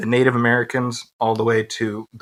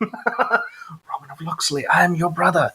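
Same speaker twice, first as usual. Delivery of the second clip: mock dramatic movie line; 5 semitones higher than usual.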